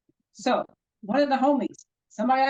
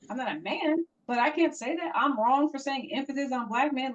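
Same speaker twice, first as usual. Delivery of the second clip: usual speaking voice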